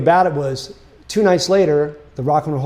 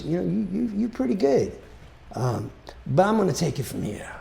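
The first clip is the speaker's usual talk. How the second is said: lightly voiced soul star, softer than usual; 4 semitones higher, 5 dB quieter than usual. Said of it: "that's my [name] impression".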